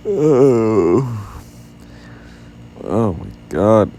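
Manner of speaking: huge loud yawn into words; loud, stretched yawning exclamation. Real, not acted. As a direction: yawn wide, then exclaim through it. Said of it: "I can't stop yawning".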